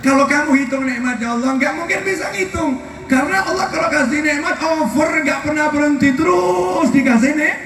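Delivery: high-pitched, fervent preaching. Real, not acted. Preacher telling listeners God's blessings never stop, fervent preaching. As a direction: press the point with rising, high-pitched fervour.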